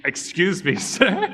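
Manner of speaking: laughing at a pretend police officer; laughing mock politeness. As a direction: politely address the officer while cracking up. Acting out a traffic stop.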